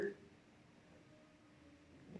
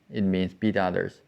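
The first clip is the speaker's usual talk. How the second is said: usual speaking voice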